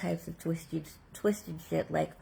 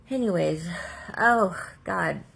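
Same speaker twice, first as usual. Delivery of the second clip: exasperated; 6 dB louder than usual. Real, not acted. Exasperated outburst.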